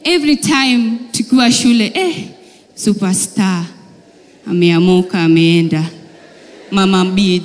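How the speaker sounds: normal talking voice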